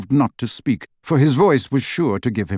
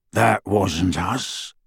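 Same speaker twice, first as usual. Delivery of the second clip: gruff werewolf; 4 semitones lower, much slower than usual. A narrator's reading. Greyback gruff denial.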